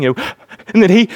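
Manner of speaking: put-on quick gasping breaths like a sobbing child; imitating gasps. Preacher imitates a crying child's gasps, mock gasping. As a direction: mimic a hyperventilating child's gasps. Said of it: "they're hyperventilating".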